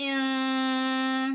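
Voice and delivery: ഇൻ with a hidden nasal hum; ikhfa. Shown as a demonstration. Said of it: "ഇവിടെ ഇഖ്ഫ ചെയ്യണം". (ഇൻ ഇഖ്ഫയോടെ)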